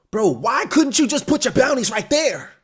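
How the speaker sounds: high-pitched, loud, worked-up protest; excited complaint. Real, not acted. Fan complaining to author about missing bounties, excited.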